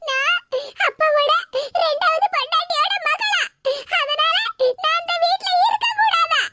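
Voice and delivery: high voice broken by put-on sobs; playing the wronged victim in crocodile tears; fake sobbing complaint. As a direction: blubber it out a few words at a time. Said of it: "நீ அழாத மக்கா".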